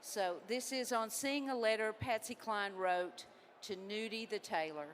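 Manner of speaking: normal talking voice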